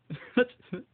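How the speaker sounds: bursts out laughing, high and loud; sudden loud laugh. Real, not acted. Ending an angry rant to viewers, laughing at himself out loud. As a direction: break into a sharp laugh.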